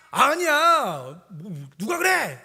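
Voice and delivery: high, loud, very slow drawn-out no; older kid doubting. Retold or imitated. Older kid challenges the son, loud slow skeptical child voice. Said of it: "좀 나이 든 놈이".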